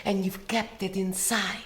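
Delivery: low, soft, conspiratorial voice; confiding hush. Pretend. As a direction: draw the listener close and speak softly. Draws listener in confidingly.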